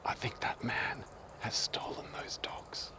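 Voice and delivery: slow, secretive whisper; dramatic whisper. Shown as a demonstration. Creating drama with other people around, a hushed conspiratorial whisper. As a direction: whisper it so nobody around can hear. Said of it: "use that technique to create drama".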